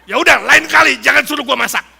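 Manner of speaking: offended snap. Retold or imitated. Cook offended by a salty remark, huffy mock anger. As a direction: storm off offended. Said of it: "Marah dia".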